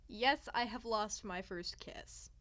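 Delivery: ordinary talk, nothing marked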